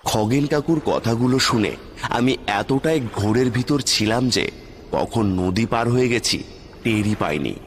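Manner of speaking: ordinary delivery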